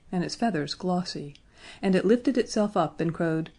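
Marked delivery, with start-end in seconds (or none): none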